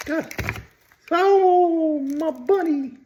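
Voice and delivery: high, cooing voice for a pet; baby talk to bunny. Pretend. Vlogger cooing to her toy bunny in a high baby voice. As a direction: coo at the toy like it's a baby.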